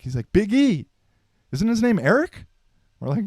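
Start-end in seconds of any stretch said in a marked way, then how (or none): 0.3-2.5 high, louder, puzzled coach voice; the coach honestly asking, pitched up. Retold or imitated. Coach confused about the name.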